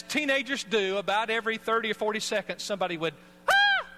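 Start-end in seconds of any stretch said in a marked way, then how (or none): 3.5-3.9 put-on high loud excited teen yelp; teen shout imitation. Imitating teenage choir members yelling at the gate, high loud yelp. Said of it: "Just like, about like that".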